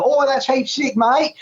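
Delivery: high, put-on Australian accent. Shown as a demonstration. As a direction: put on a broad Aussie accent. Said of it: "Can I do the Australian accent?".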